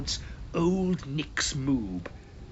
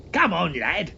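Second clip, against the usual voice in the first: loud urgent call; shouted; 5 semitones higher, 6 dB louder than usual. A narrator's reading. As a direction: shout to hurry the boy along. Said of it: "shouted his uncle".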